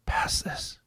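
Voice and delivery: playful hushed whisper; mock-confidential whisper; whispery. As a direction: whisper it very softly, without voice. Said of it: "that was kinda whispery, like Joe Biden".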